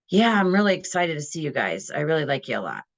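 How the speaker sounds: deadpan fake enthusiasm, dropping low; unbelievable, joyless welcome; unconvincing excitement. Shown as a demonstration. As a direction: say the glad words with no lift at all. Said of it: "that's not believable".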